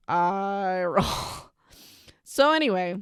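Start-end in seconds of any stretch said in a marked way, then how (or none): 0.1-1.5 long, drawn-out groan of "I roll"; stretched-out, exasperated aside; exasperated eye roll. Real, not acted. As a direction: drag it out slowly, audibly fed up. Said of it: "If you're not watching the video, you can, like, totally".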